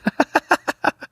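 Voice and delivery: high-pitched burst of laughter; laughing. Real, not acted. Laughing at sign language suggestion.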